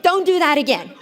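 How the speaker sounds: loud mock-angry shout; angry warning. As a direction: tell him off loudly. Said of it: "he made me so angry".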